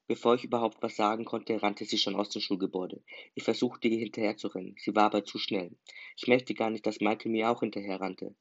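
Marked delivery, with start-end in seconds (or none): none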